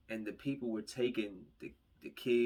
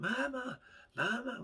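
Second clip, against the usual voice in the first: mock child's cry for her mother; child screaming mama; 13 semitones higher than usual. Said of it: "was screaming".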